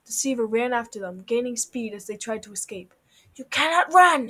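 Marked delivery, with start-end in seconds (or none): 3.3-4.3 loud, raised-pitch villain shout; menacing yell. A narrator's reading. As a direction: yell after fleeing prey. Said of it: "he yelled after them".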